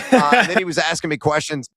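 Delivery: ordinary talk, nothing marked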